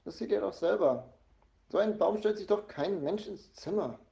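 mildly indignant scolding; annoyed. A narrator's reading. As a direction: argue in cross disbelief. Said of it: "sagt Mama ärgerlich".

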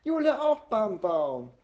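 put-on high, slow toddler yell; drawn-out little child's demand; shouting toddler. A narrator's reading. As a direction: holler it like an insistent toddler. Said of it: "schreit Jule auf Mamas Arm".

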